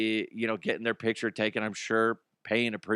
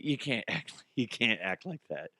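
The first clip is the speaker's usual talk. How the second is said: normal talking voice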